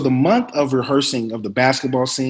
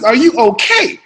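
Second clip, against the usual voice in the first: loud, high, emphatic repeated question; Sam Jackson impression; 9 semitones higher, 7 dB louder than usual. Veteran actor insisting on an answer from the newcomer, emphatic impression. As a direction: press the rookie for an answer. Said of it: "He said".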